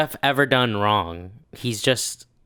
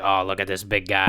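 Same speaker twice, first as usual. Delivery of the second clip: lower-pitched sarcastic mock admiration; 5 semitones lower, 3 dB quieter than usual. Guest imitating trolls mocking Jeff's size, sarcastic. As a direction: sneak the insult into fake praise, dropping the pitch. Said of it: "they have to do it sarcastically".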